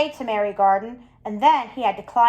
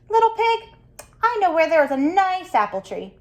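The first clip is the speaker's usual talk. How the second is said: higher, coaxing wolf voice luring the pig; sly sweet wolf; 7 semitones higher than usual. Acted. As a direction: lure him in with a sugary lilt.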